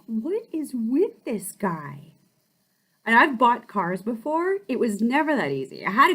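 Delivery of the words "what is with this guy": puzzled aside. Retold or imitated. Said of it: "I'm like".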